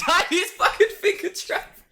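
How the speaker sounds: high, squeaky real laughter through words; cracking up. Real, not acted. Podcaster repeating co-host's joke, real high helpless laughter. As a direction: barely get it out for laughing.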